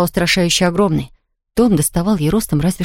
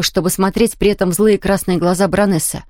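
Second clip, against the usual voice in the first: ordinary delivery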